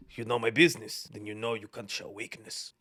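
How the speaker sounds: low, gruff tough-guy father voice; mock the hard-man dad in a deep voice; hammed-up macho father, dropping low and soft at the end; Russell Crowe impression. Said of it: "He just goes".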